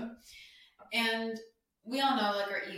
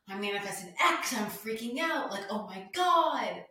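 mock freak-out; 3 semitones higher, 5 dB louder than usual. Mimicking people posting their manifestations online, mock freak-out. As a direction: imitate an overexcited poster, stretching the last words.

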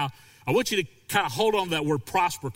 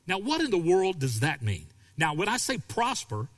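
usual speaking voice

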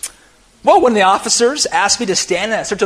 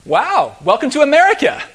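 loud, high-pitched sarcastic welcome; retold sarcastic jab, voice raised; 7 semitones higher, 5 dB louder than usual. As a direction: throw it out with mock enthusiasm. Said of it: "I made a sarcastic comment like".

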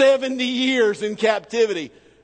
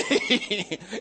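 laugh; 5 semitones higher, 4 dB quieter than usual. Real, not acted. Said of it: "laughs at the retold scream".

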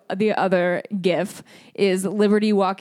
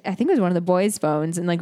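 ordinary talk, nothing marked

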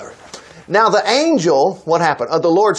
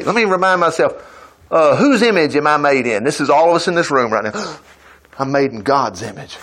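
ordinary talk

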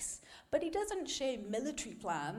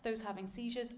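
ordinary delivery

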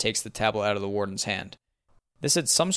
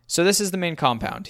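lightly voiced cheery camp counselor, pitched up; a touch of chirpy tour guide, higher at the start; 8 semitones higher than usual. A narrator's reading. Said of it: "cringy camp counselor vibe".

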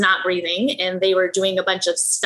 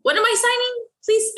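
confused alarm. Retold or imitated. Confused signing papers on drugs.

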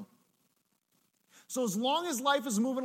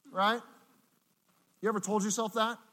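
usual speaking voice

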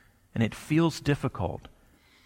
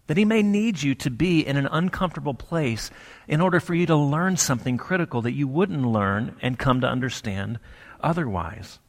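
normal talking voice

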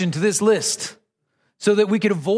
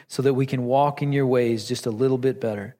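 hushed closing prayer, lower and softer; 6 semitones lower, 3 dB quieter than usual. Acted. Pastor closing a prayer before the sermon, hushed and low. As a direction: pray it quietly to end the prayer.

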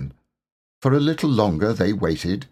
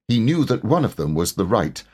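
ordinary delivery

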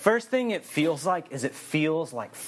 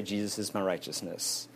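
softer, lower murmur; quiet affirmation; 5 semitones lower, 7 dB quieter than usual. Shown as a demonstration. Preacher modelling answer to bragging, soft murmur. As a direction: say it quietly to yourself. Modelling humble self-talk.

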